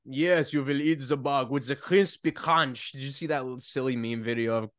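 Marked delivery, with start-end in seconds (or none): 0.1-2.9 put-on low, slow German-accented villain; hammed-up German mastermind, drawn out; mock German accent. Mimics Klaus Schwab meme, German accent.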